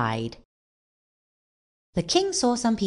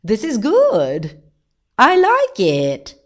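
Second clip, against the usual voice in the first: slow, raised, loud royal shout; delighted shout; 5 semitones higher, 6 dB louder, slower than usual. A narrator's reading. King enjoys his wheel ride, delighted shout. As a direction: shout it out high, stretching the words. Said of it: "shouted the king".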